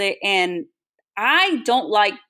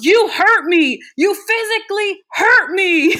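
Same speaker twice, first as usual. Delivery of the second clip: high, loud, wounded whine, much louder; mock a man whining he got hurt; whiny hurt guy; 11 semitones higher, 6 dB louder, slower than usual. To the woman refusing him, a high loud mock whine. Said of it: "and he's like".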